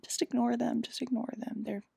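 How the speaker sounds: very soft, sinking to a whisper; hushed aside. Retold or imitated. Katara telling upset Toph to ignore bullies, hushed. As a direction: drop the voice confidentially, ending whispered.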